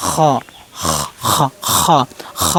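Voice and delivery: full, heavy খ from the end of the throat; heavy throat letter. Shown as a demonstration. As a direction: say খ thick, scraped at the back of the throat. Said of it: "খ হরফটা মোটা".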